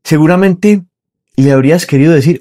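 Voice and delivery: normal talking voice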